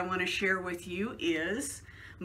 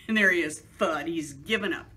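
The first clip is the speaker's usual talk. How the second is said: plain reading voice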